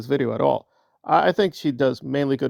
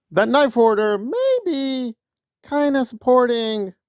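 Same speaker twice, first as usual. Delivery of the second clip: dramatic ominous; 9 semitones higher, slower than usual. Acted. Ominous reveal about rival.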